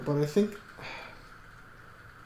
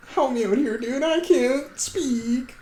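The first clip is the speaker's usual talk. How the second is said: high, drawn-out whine for help; hammed-up helpless whine; mock pleading; 11 semitones higher, 4 dB louder, slower than usual. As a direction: plead helplessly in a much higher pitch. Mock pleading over failed accent.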